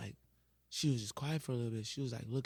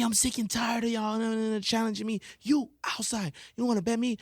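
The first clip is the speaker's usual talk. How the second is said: angry teacher; 9 semitones higher, 6 dB louder than usual. Retold or imitated. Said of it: "She was like".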